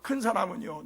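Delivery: normal talking voice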